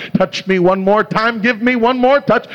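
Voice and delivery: high, climbing plea to God; pleading prayer. Retold or imitated. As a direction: cry out to the Lord, rising with each request.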